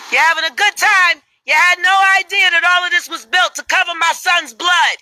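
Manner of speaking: high, loud furious shout; grieving mother yelling at visitors. Acted. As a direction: scream it at the crowd.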